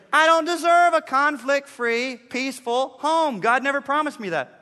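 preaching the new thought with fervor; emphatic declaration. Shown as a demonstration. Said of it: "So this was helpful to say".

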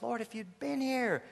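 a quiet, hurt echo of Martha; disappointed. Acted. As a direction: linger on the loss. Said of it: "the disappointment in Martha's voice".

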